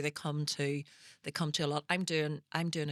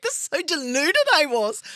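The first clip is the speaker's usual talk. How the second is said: amused; 10 semitones higher, 9 dB louder than usual. Real, not acted. Laughing self-mockery.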